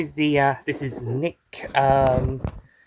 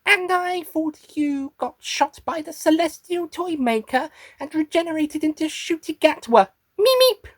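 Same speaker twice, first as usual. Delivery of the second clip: high-pitched little creature; Meep character voice; 9 semitones higher than usual. Acted. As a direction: talk high as the Meep, shriek-high on the catchphrase. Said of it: "getting the voice into, uh, into character".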